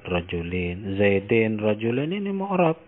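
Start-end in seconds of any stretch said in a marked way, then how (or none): none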